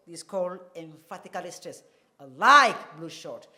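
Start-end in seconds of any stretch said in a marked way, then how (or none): 2.2-3.5 like punched loud, the rest even; stress on like. Shown as a demonstration. Teacher showing the class, stress on like. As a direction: hit the word like hard. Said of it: "It means I don't hate blue shirt".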